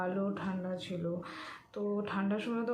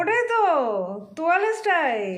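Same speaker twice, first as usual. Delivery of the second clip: high, loud exclamation; loud exclaiming; 8 semitones higher, 12 dB louder than usual. Real, not acted. Playful argument about towel hairstyle.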